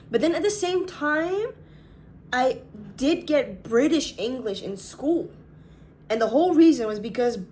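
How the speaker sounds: ordinary talk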